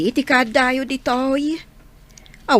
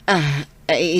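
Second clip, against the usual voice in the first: ordinary delivery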